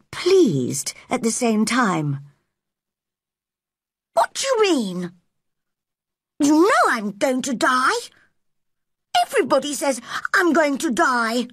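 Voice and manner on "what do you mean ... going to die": high, indignant protest; angry boy. Acted. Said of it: "He was angry".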